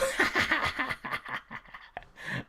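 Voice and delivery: long, high, breathy laughing fit; helpless laughter. Real, not acted. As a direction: lose it completely laughing. Cracking up at the name.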